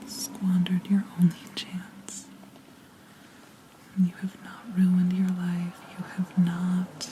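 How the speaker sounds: ordinary talk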